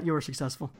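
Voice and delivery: ordinary delivery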